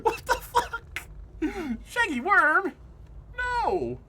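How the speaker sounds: very high, loud panicked squeals; real fright, yelping high and loud; terrified shriek. Real, not acted. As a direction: shriek half laughing, half crying. Jumpscare, laughing-crying panic.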